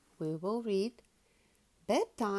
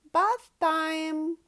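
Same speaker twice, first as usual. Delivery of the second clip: called out a little louder; Daddy Pig calling the children in for their bath; 7 semitones higher, 4 dB louder than usual. Acted. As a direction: call the kids home. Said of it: "calls Daddy Pig".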